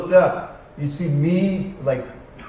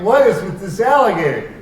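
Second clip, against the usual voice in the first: exasperated; 6 semitones higher, 4 dB louder than usual. Retold or imitated. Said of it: "I'm like".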